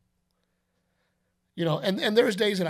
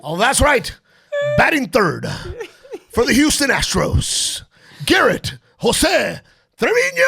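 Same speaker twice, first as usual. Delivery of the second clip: loud, high, booming ballpark announcer; baseball announcer voice; 8 semitones higher, 9 dB louder than usual. Retold or imitated. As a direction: roar the lineup like a stadium announcer. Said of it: "announcer's voice".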